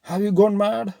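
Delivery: high, loud alarmed cry; shocked shout. Acted. Ajit seeing his wife set fire to her sari, alarmed shout.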